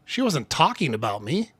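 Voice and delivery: "talking" emphasized, with a rising end; stress on TALKING. Shown as a demonstration. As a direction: lean on "talking", lift the end like a question. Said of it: "because of the word I emphasize".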